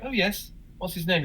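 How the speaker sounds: high-pitched gallery staffer on the phone; lightly voiced receptionist. Voices gallery staff answering caller.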